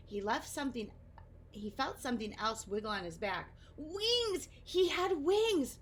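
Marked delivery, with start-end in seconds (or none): 3.8-4.5 "Wings!" leaping very high; surprised delight. Acted. Discovering his wings. 4.6-5.8 slow, high, louder "He had wings!"; excited wonder. Acted. Discovering his wings.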